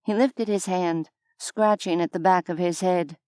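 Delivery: plain reading voice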